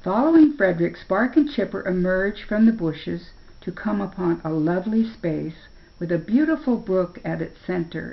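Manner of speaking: ordinary delivery, nothing marked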